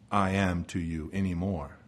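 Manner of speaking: dropped low, subdued voice; grave hush. Acted. God revoking the covenant with his people, grave hush. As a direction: let pitch fall away solemnly. God's rejection voiced again.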